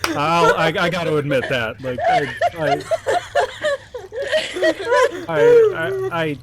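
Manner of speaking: laughing, voice breaking high; losing it, high and loud; cracking up. Real, not acted. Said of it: "I'm a little broken".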